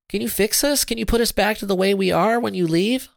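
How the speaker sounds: high-pitched, urgent plea; pleading. A narrator's reading. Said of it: "Sarah pleaded".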